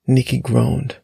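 ordinary delivery

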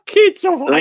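high, loud mock caller voice; mimicked phone caller. Host parroting the early-morning caller, high mocking imitation. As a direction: squeal it out in imitation.

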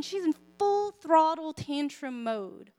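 usual speaking voice

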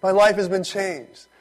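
high, loud gush of gratitude; overwhelmed, grateful cry; loud thanking. Acted. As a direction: pour out thanks to God at full voice. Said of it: "says with a loud voice, he's thanking him".

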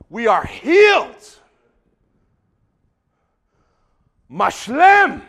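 loud, slow preacher's declaration; ringing, drawn-out cry; triumphant proclamation. Real, not acted. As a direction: proclaim it with full force.

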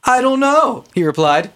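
high, flippant sarcastic reading. Acted. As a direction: read it with a smirk. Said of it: "a weird connotation when you read it sarcastically".

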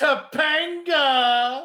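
loud, high, stretched out; excited drawn-out shout. Real, not acted. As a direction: call the name out slow and gleeful.

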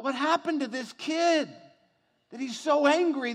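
loud, high, indignant preaching; preaching at full force. Real, not acted. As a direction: hammer the congregation with outrage.

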